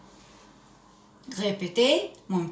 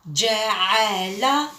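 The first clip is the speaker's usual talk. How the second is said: slow syllables; much slower than usual. Shown as a demonstration. Teacher reading جعل slowly to young pupils. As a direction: stretch each syllable of جعل slowly for the class. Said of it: "teacher models reading slowly".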